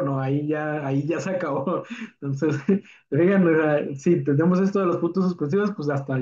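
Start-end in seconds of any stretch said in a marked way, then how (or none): none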